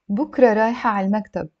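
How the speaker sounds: slightly brisk model reading; connected, natural-speed sentence. Shown as a demonstration. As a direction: run the words together fluently. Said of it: "I'll read it a little bit faster".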